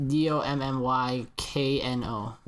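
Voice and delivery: slow, letter-by-letter delivery; deliberate spelling, each letter separate; spelled out slowly. Real, not acted. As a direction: spell it out one letter at a time. Spelling out a made-up name.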